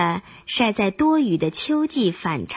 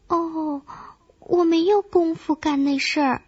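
lightly voiced, higher-pitched grasshopper; grasshopper character voice; 7 semitones higher than usual. A narrator's reading. Grasshopper answers the scolding ant, in a higher voice. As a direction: excuse yourself in a raised little voice.

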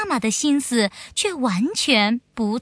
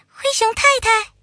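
put-on high, quick little girl voice; little girl bear voice; 10 semitones higher, 4 dB louder, faster than usual. A narrator's reading. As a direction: call out like an eager little cub. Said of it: "小熊妹妹说".

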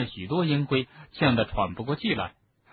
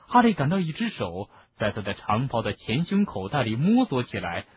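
ordinary delivery

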